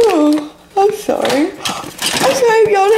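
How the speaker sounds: slow, high apology after a gasp; drawn-out, squeaky sorry; high-pitched apology. Real, not acted.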